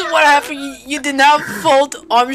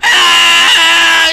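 long, loud yell; drawn-out cry of fright; scream of panic; 5 dB louder than usual. Real, not acted. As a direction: keep screaming as it chases you. Still being chased.

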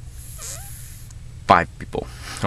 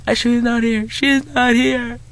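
mock frantic plea. Mom answering the intruder through the door, mock frantic. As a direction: imitate a panicked mother's high voice.

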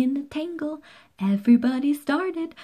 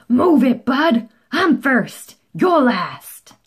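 loud pushy shout at the little truck; gruff yell; 2 semitones higher, 7 dB louder than usual. Acted. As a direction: bark it like a rude grocery truck.

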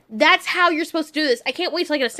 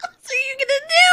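laughing; 11 semitones higher than usual. Real, not acted. Laughing at the scene.

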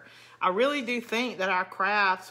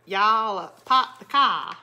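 louder mock Boston accent, r's dropped; attempted Boston accent; 3 semitones higher, 8 dB louder than usual. Southerner teasing Boston viewers, mock Boston accent. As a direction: have a clumsy go at Boston vowels. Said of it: "Boston accent is probably even funnier".

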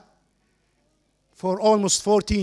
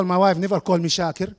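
usual speaking voice

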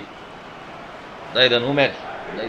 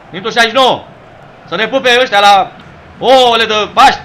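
loud, high announcing turned rant; exasperated rant; 7 semitones higher, 7 dB louder than usual. Real, not acted. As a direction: shout it out, disgusted with the team. Ranting after lost shot.

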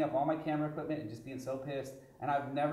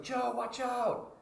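high, drawn-out warning; stretched mock shout of alarm; retold warning call; 8 semitones higher, much slower than usual. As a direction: imitate a friend calling out a danger.

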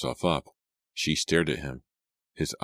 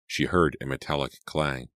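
ordinary delivery, nothing marked

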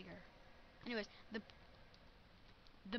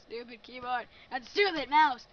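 frustrated loud complaint; 11 dB louder than usual. Real, not acted. Gamer despairing over controls.

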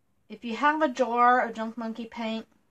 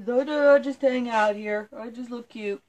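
voicing the dog; 3 semitones higher, 4 dB louder than usual. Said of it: "He says".

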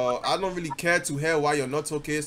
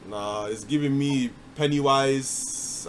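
ordinary talk, nothing marked

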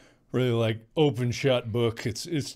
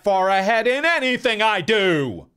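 shouting; 10 semitones higher, 11 dB louder than usual. Pretend. Teasing argument, shouting back.

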